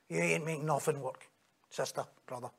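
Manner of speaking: dropped low and soft, almost under the breath; a hushed, knowing reproach; low quiet rebuke. Acted. Preacher quietly rebuking the self-reliant believer, hushed and low.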